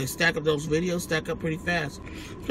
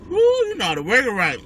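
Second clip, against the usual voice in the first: excited; 8 semitones higher, 6 dB louder than usual. Real, not acted. Excited praise of chef.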